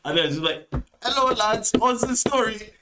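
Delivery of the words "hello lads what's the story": high-pitched mocking guard voice; mock guard greeting. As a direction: imitate an officer's greeting, pitched comically high. Acts out guard approaching the lads.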